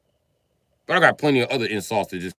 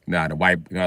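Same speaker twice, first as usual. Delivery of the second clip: ordinary talk, nothing marked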